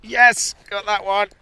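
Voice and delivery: high, loud shout of success; real excited cry. Real, not acted. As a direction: cheer as the fish hooks. Angler hooks another fish.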